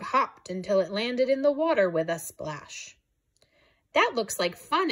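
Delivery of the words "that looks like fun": higher, eager about the game; excited exclamation. Acted. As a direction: lift it with delight. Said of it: "exclaimed Cla-, Calf".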